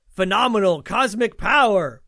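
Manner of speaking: booming, slow genie proclamation. Retold or imitated. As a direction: belt it out like a grand genie. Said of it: "just like the genie said".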